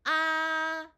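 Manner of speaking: "아" held high, level and loud; first tone. Shown as a demonstration. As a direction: sustain "아" on one high note. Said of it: "일성부터 사성까지 제가 연이어서". ("아"를 높은 음으로 크고 평평하게 길게 유지)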